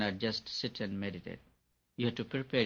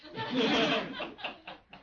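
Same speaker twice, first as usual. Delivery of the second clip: a bright high laugh that falls away; 13 semitones higher than usual. Real, not acted. Teacher laughing at God's reply in his story, laugh falling away. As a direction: laugh high then let it drop.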